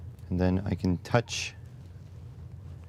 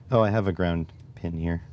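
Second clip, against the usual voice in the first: ordinary delivery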